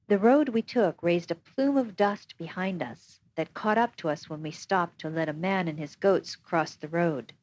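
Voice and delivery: usual speaking voice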